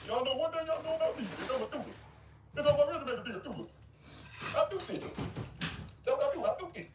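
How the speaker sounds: high, silly singsong mockery of a rival; mocking high goofy voice. Streamer mocking a grown man's boasting, high goofy voice. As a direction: parody his boast in a dopey high voice. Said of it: "talking like this".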